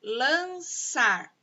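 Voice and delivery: "lançar" drawn out as LAN, ÇAR; stretched, divided "lançar"; syllable by syllable. Shown as a demonstration. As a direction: sound out "lançar" in two separate beats. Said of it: "Dois sons".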